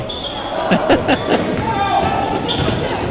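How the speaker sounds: high breathy laugh at his own joke. Real, not acted. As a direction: chuckle at the joke you just made. Joke about giving up Valentine's.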